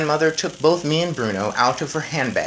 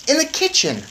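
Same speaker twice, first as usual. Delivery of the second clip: put-on high, eager boy voice; a clever boy explaining; 4 dB louder than usual. A narrator's reading.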